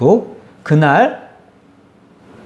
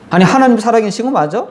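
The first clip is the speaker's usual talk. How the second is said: mocking doubt; 8 semitones higher than usual. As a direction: challenge God with a sneering rise. Doubting people quoted twice.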